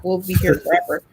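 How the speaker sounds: ordinary talk, nothing marked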